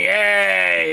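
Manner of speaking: lightly voiced Cousin Tony greeting; a hint of a brash New York cousin. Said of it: "Cousin Tony".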